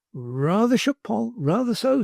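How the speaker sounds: put-on high, cocky boy's voice, a bit giddy; lightly voiced dazed lad; Bailey dazed. A narrator's reading. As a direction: answer groggily but cheeky. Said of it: "said Mister Bailey".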